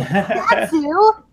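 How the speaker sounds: high-pitched, drawn-out name; retold excitement. Guest re-enacting news of Satsu, excited squeal. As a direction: replay the thrilled reaction to a favourite guest. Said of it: "more excited for".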